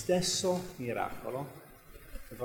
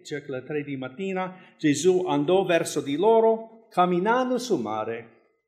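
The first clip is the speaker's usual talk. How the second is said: raised, louder Bible reading voice; scripture reading; 3 semitones higher, 7 dB louder than usual. Acted. Preacher reading the gospel to the church, raised reading voice. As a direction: read the passage out to the congregation. Reads Matthew 14 aloud.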